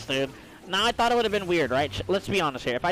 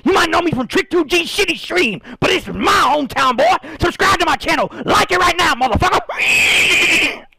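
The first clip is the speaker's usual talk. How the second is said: loud brash hype-man shout; Horsey hype voice; 9 semitones higher, 10 dB louder than usual. Pretend. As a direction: bellow it like a wild promo. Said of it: "It's me, Horsey".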